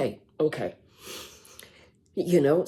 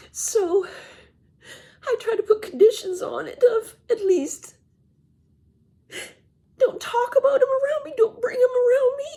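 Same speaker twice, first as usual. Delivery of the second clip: real sobbing, barely getting words out; crying. Real, not acted. Daughter weeping about her mother and her abuser, crying. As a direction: voice breaking high through tears, half laughing.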